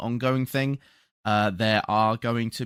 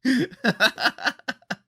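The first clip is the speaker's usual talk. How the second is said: high laugh; 14 semitones higher, 5 dB louder than usual. Real, not acted. Laughing at sponsor plea.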